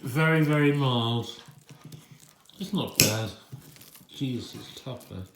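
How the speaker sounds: talking while chewing meat. Real, not acted. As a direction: speak through a mouthful. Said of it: "sorry for any munching sounds".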